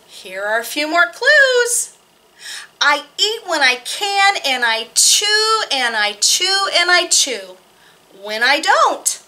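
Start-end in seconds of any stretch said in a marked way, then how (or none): none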